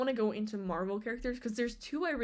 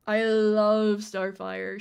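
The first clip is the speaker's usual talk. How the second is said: drawn out slowly, louder; gushing; 8 dB louder, much slower than usual. Real, not acted. Fan gushing over Starfire, slow and loud. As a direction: savour each word of love.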